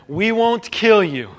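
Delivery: an official threatening the kneeling believers; stern threat. Retold or imitated. Official to church members, slow stern threat.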